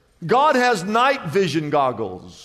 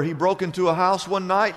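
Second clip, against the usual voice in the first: normal talking voice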